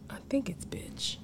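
soft unvoiced whisper. Real, not acted. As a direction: whisper the answer under your breath. Whispering a cuss word.

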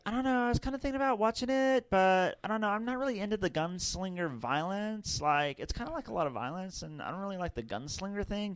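put-on wishy-washy teenager musing; valley girl voice. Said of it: "This is how she talked".

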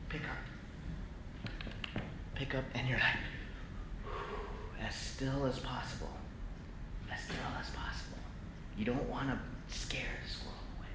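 near-whisper, keeping very still; hushed. Retold or imitated. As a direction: breathe it so the squirrel won't bolt. Hushed while coaxing a squirrel.

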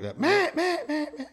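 high nasal quacking; hyper duck quack. Shown as a demonstration. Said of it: "that more hyper duck".

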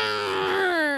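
imitating the cat growling. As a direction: growl like a cat gone wild. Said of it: "he goes".

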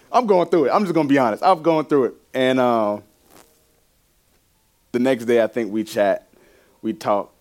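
normal talking voice

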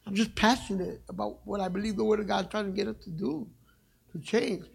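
usual speaking voice

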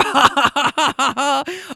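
high cackling imitation; hammed-up delighted laugh; mock laughter. Riders cracking up at his joke, high mock laugh.

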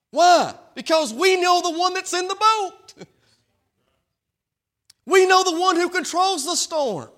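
high, loud, driving preacher cadence; full-throated gospel sermon; preaching at full force. Acted. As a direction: preach it out to the whole church.

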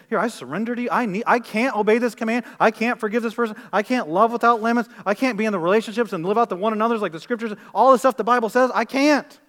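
impassioned preaching. Acted. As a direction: preach it as a fervent surrender. Preaches at full force.

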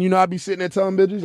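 ordinary talk, nothing marked

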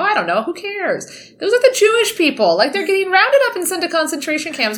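high, breezy, careless voice; dismissive Molina. Retold or imitated. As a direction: toss it off like you don't care. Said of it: "And Molina's like".

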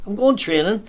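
high, stressed on going; indignant insistence. Retold or imitated. Lad defending himself to the drinker: high indignant insistence. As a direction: insist with wounded pride. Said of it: "And here he was".

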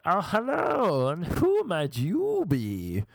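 high, drawn-out mock-posh greeting; theatrical English-sounding creep. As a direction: stretch the vowels in an exaggerated creepy put-on voice. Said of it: "Is that your real voice?".

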